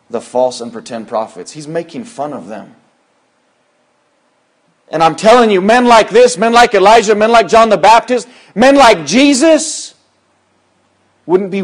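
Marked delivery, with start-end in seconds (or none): 4.9-11.6 higher and louder, building to the name Jesus; fiery preaching. Acted. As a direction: thunder it from the pulpit. Preacher building to full force.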